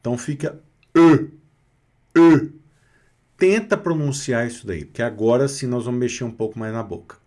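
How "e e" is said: E said with O-rounded lips, louder; E, rounded, projected; French rounded e. Shown as a demonstration. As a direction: think E while holding the O mouth. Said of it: "tenta pensar no E".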